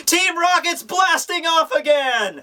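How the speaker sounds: high, drawn-out cartoon yell; catchphrase shout. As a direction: holler it as if flung into the sky. Catchphrase yelled as a joke.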